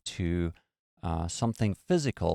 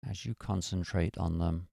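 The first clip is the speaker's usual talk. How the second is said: guided meditation. Acted. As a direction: ease them into stillness. Leads a meditation.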